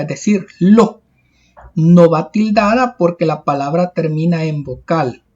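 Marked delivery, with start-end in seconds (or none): 0.6-1.0 lo picked out alone with force; a firm, emphatic lo; stressed syllable isolated. Shown as a demonstration. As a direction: punch lo as the strong syllable of pelota. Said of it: "Lleva la mayor fuerza de voz".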